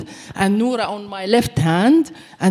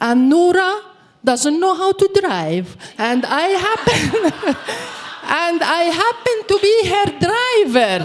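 higher, slightly amused explaining to the soldier; mock-innocent, lay it out for the soldier; explaining to the soldier why she drives the dog; deadpan explanation; 8 semitones higher than usual.

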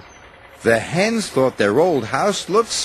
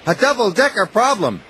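higher, bright little engine voice; Thomas's voice; 8 semitones higher, faster than usual. A narrator's reading. Thomas speaks, then Hatt repeats.

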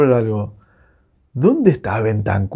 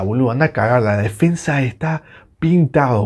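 ordinary talk, nothing marked

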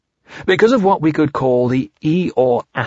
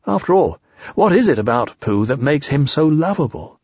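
plain reading voice

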